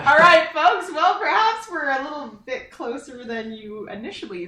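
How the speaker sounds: jokey host voice, starting big; mock announcer. Singer jokingly addresses folks about bandmates' closeness, mock announcing.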